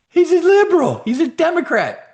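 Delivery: high, loud attack-line yell; mock outraged shout.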